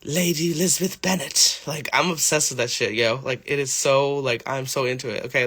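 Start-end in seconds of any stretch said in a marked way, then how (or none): 0.0-1.6 lightly put-on period-drama English accent; a touch of costume-drama English. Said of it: "like, a period drama".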